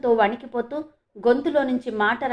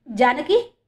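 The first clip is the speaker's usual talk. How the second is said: louder, rising exclamation; loud cry; 5 dB louder, much slower than usual. A narrator's reading. Father sees his lost daughter, loud cry. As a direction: call the name out in astonishment. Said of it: "అంటూ గట్టిగా అరిచాడు".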